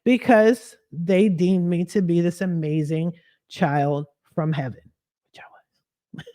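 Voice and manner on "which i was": hushed, whispered boast; whispered aside. Real, not acted. Sly aside about being an amazing child, whispered. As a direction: slip it in under your breath.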